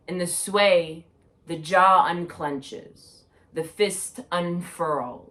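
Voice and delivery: projected, measured spoken-word delivery; poetry reading. Acted. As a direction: recite it like a poem to an audience. Reads own poem aloud.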